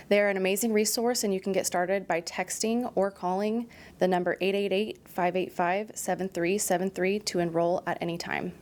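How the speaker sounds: even, scripted ad-read voice; sponsor ad read. Acted. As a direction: read the sponsor spot like a short podcast ad. Sponsor message read aloud.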